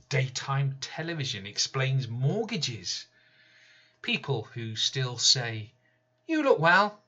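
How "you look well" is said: high, bright mock-cheery voice; sing-song false cheer, pitched high; chirpy visitor's greeting. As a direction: parrot the well-meaning small talk. People who still say.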